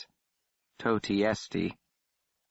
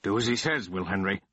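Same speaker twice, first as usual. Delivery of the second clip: sharp. Acted. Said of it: "Warthrop ordered in a sharp voice".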